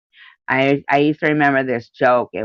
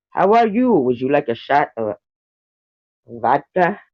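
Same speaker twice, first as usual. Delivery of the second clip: mock Russian. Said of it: "Russians do kinda roll their R's".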